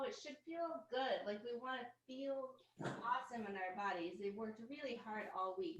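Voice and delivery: usual speaking voice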